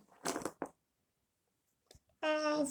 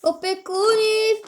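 calling out; 7 semitones higher, slower than usual. Acted. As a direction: shout for the caretakers to come. Calling the caretakers over.

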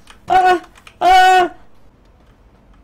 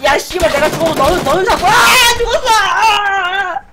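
wailing shout; crying out in despair; panicking gamer; 6 semitones higher, 8 dB louder than usual. Real, not acted. Dying in game.

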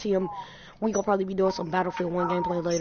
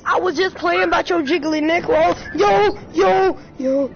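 high, louder rushed plea; panicky pleading; 7 semitones higher, 4 dB louder than usual. Real, not acted. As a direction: beg for mercy, rising in panic.

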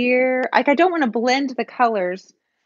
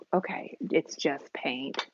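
slow, low near-whisper; steady yourself under your breath, slowly; hushed self-reassurance; 8 semitones lower, 9 dB quieter, slower than usual. Real, not acted. Painter calming herself before scraping the canvas, hushed self-reassurance.

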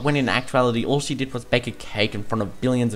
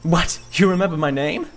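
higher, surprised youngster; be thrilled your idol knows you; Twink, amazed star kid; 6 semitones higher than usual. Acted. Young star recognised by his idol, amazed.

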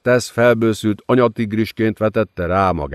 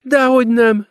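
giggling. A narrator's reading. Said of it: "kuncogott Lilith".

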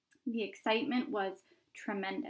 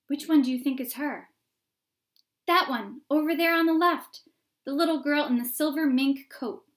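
crowd onlookers; 5 semitones higher, 9 dB louder than usual. Acted.

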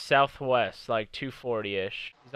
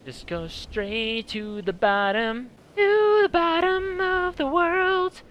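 high, slow, hammed-up mock voice; mock dramatic voice; 4 dB louder, slower than usual. Player teases partner heading to the bottom. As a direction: stretch it out like a silly cartoon. Mocking partner's bad directions.

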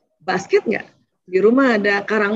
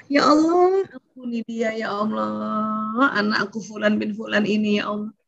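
hushed sample prayer; 4 dB quieter than usual. Shown as a demonstration.

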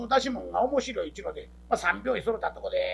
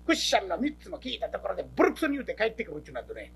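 ordinary talk

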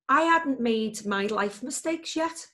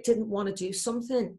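ordinary talk

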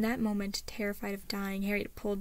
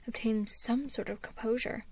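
plain reading voice

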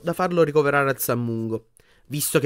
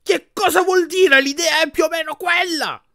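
furious yelling; 5 dB louder than usual. A narrator's reading. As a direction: shriek at a husband from behind a door. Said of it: "sentirono la signora Weasley urlare".

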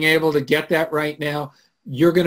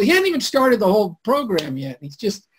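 normal talking voice

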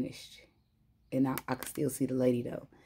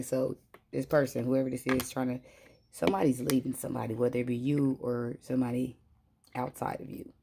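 ordinary delivery

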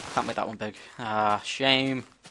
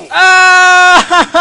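sharp high shriek of fright; piercing high scream; startled scream; 17 dB louder than usual. Real, not acted. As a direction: yelp as if something jumped you. Sudden scare in game.

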